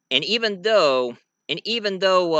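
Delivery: plain reading voice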